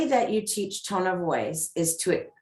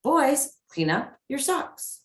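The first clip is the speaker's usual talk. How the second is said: put-on "Boys" pitched high, "socks" lifting at the end; light respectful tone; 6 semitones higher, slower than usual. Shown as a demonstration. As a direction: ask it lightly, voice going up.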